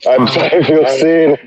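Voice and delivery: slow, laughing confession. Real, not acted. Laughing at being outed.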